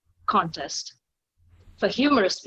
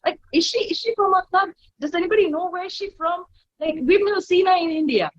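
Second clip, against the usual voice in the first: high-pitched, astonished crowd chatter; eager questions tumbling out in a raised voice; excited audience; 10 semitones higher, 3 dB louder than usual. Retold or imitated. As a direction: imitate thrilled, baffled spectators. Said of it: "they got so excited".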